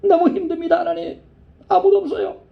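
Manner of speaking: high, pleading lament to God; lonely crying prayer. Retold or imitated. Woman alone after surgery cries out to God, high and pleading. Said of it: "너무나 외로워서 펑펑 울었답니다".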